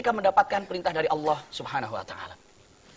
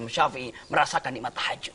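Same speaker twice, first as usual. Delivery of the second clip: ordinary delivery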